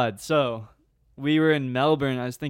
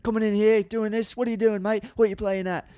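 high mock Australian yell; hammed-up angry Aussie driver; Australian accent; 8 semitones higher than usual. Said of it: "in that Australian slang".

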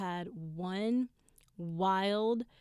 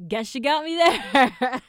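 loud, drawn-out cry of surrender; mock defeated shout; 6 semitones higher, 15 dB louder, slower than usual. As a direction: belt it out slowly, conceding with a laugh. Retold reaction, shouted then repeated.

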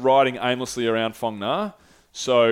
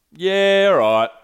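louder, higher hesitant yeah; grudging yes to Sean; forced agreement; 7 semitones higher, 7 dB louder, much slower than usual. Retold or imitated. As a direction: fake enthusiasm over reluctance.

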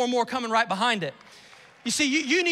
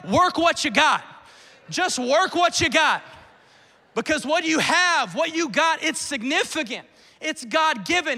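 raised, driving preacher voice; emphatic, louder sermon delivery; impassioned preaching; 3 semitones higher, 4 dB louder than usual. Real, not acted. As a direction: preach it with force to the congregation. Preaches at full force to congregation.

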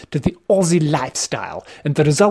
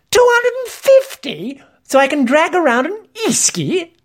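incredulous, much higher-pitched protest; outraged exclamation; 14 semitones higher than usual. A narrator's reading. Narrator balking at esky fees, outraged exclamation. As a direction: balk at the cost, voice shooting up. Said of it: "I exclaimed".

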